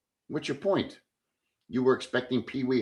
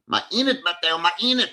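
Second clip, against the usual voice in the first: imitating the girl; 6 semitones higher than usual. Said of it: "the girl came to my house".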